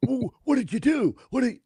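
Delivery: high-pitched, soft mock outrage; high, hammed-up fussing; mock mad person. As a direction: squeal it in pretend anger. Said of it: "really mad and they're like".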